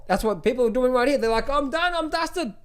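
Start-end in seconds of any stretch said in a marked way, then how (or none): 1.5-2.5 high, slow whiny "I'm done" voice; mock quitter. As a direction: whine it like you're giving up. People bailing out, imitated.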